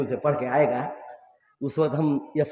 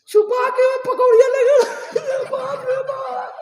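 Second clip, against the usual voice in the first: much higher, louder mock complaint; mocking mother; 5 dB louder than usual. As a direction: squeal it like a wronged mother. Mother complaining about hidden pakoras. (माँ की ऊँची नकल)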